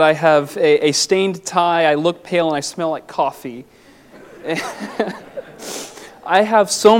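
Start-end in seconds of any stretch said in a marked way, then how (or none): 2.5-4.4 words melting into a soft chuckle; laughing at himself. Real, not acted. Preacher joking to the church about looking tired, laughing. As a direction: laugh it off, a bit embarrassed. Jokes about his rough morning look.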